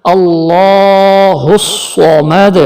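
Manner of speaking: اللہ الصمد with a thick lam, slow chant; heavy Allah. Shown as a demonstration. Teacher reciting اللہ with a heavy lam. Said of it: "ہم اسے موٹا کر کے پڑھیں گے".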